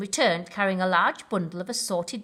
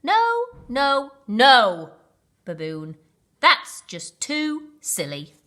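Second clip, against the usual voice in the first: exasperated; 5 semitones higher, 8 dB louder, slower than usual. Acted. Rhino exasperated.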